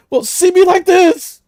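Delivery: high, loud, drawn-out plea; desperate cry. Acted. Possessed man begging the woman he loves, desperate cry. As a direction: throw it out desperately.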